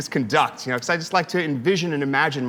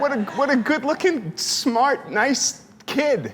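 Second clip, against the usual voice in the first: choked up with emotion; 9 semitones higher, slower than usual. Real, not acted. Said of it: "I get emotional".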